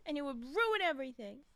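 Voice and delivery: usual speaking voice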